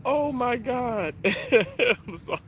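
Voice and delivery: real tearful laughter, high and halting; crying. Real, not acted. Vlogger overwhelmed by sign from mom, crying. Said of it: "I'm sitting here crying".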